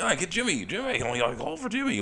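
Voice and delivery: high, urgent call for help; panicked manager calling. Retold or imitated. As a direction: shout for the medic in alarm. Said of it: "He's like".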